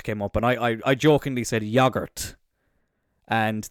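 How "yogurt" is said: "yogurt" with a short first vowel, YOG-urt; snobby pronunciation. Retold or imitated. Host jokingly said it to friends, "yogurt" with a short o. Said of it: "just to be a bit snobby".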